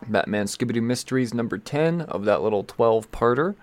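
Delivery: normal talking voice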